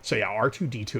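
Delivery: ordinary talk, nothing marked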